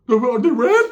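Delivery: high, loud cartoon-mouse cousin; Mickey Mouse voice. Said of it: "put the Mickey Mouse to it".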